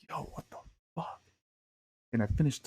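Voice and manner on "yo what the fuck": breathless whisper of disbelief. Retold or imitated. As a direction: hush it, stunned. Awed reaction to blue sky.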